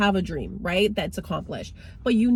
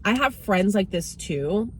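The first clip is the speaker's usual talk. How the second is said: usual speaking voice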